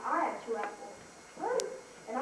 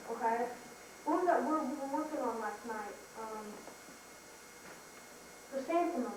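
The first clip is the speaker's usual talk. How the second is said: ordinary delivery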